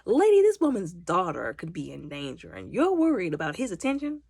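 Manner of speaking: loud, rising disbelief; exasperated, emphatic call-out; incredulous scolding. Retold or imitated. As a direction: tell her off, appalled at her priorities. Teller scolds the warden, incredulous.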